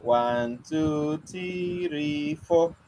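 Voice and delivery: sly slow count with a long "three"; counting trick again. Shown as a demonstration. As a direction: hold "three" long and soft to hide a finger. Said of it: "So what did you notice?".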